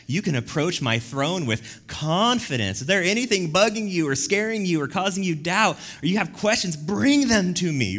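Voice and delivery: high, loud, emphatic welcome as God; God's open invitation. Acted. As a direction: urge them forward with rising insistence. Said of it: "We have a God who's like".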